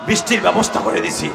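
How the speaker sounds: loud, forceful declaration; emphatic shout. Acted. God tells Musa of the rain, shouted proclamation. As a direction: shout the announcement out. God's answer repeated forcefully.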